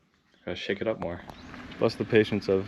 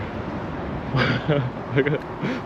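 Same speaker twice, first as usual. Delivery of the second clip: giddy laugh; 5 semitones higher than usual. Real, not acted. Giddy at free charging.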